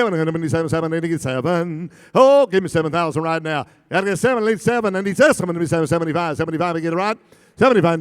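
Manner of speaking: ordinary delivery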